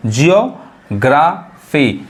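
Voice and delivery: Geography very slowly in parts, "jiyo... graa... fee"; slow teaching breakdown of geography; syllable by syllable. Shown as a demonstration. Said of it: "यानी GEO".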